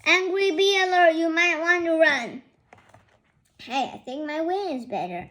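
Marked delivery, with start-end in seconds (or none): 0.1-2.4 loud high alarm call; urgent warning. Acted. Warning friend about bees, loud urgent call.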